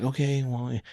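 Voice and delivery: soft, held-in mock impatience; quiet impatience. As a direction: play it politely impatient, under the breath. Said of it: "I'm quietly impatient".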